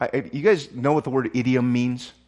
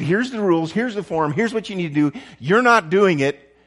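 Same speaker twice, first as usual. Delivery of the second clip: louder, insistent listing of rules; exasperated lecturing; 5 semitones higher, 5 dB louder than usual. Retold or imitated. Pastor mimics a tired leader listing rules, exasperated. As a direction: hammer each rule home, then snap the accusation. Tired rule-giver quoted.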